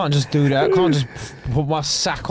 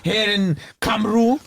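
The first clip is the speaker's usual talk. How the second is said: put-on Welsh accent; 6 semitones higher than usual. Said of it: "Why is that so funny in a Welsh accent".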